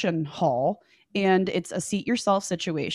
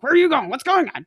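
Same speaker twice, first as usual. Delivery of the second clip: imitating a panicked guy, higher and louder; panic mode; 7 semitones higher, 4 dB louder than usual. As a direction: blurt it out in a mock panic. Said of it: "like, panic mode".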